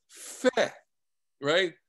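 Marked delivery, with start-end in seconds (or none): 0.1-0.7 feh, higher and scornful; a light scornful feh; mock dismissive feh. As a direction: brush it off with a scoffing feh. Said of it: "It's a way of saying".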